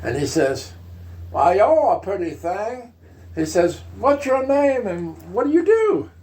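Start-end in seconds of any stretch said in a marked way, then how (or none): 1.3-2.9 put-on flirty Texas accent, pitched up; Lyndon Johnson. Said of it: "pronounced Texas accent like that". 4.0-6.1 put-on high, forward Texas accent; Lyndon Johnson. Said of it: "pronounced Texas accent like that".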